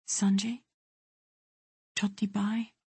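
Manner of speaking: softly, with grave tenderness; a hushed, tender call to her brother. A narrator's reading. Sister softly calls her weeping brother, tender. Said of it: "Softly, and with grave tenderness".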